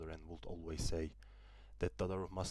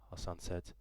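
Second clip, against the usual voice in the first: ordinary delivery, nothing marked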